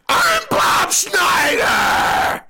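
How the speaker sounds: huge, high, drawn-out yell of your own name; full-blast introduction, every word stretched; over-the-top panicked shout. Acted. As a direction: bellow it like a maniac at an audition. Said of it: "And I go like this".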